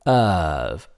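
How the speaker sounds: "serve" met Brits accent, zonder r; British accent. Shown as a demonstration. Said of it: "say it in the British accent".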